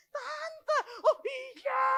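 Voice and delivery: very high mock sobbing call; retold tearful cry. Child hugging auntie in grief, high imitated cry.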